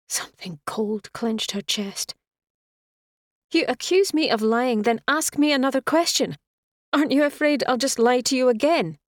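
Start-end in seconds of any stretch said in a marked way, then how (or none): 3.5-9.0 high, loud indignant challenge; indignant retort. A narrator's reading. Woman answering the man who called her a liar, indignant. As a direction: throw the accusation back, voice raised.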